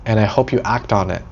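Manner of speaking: ordinary talk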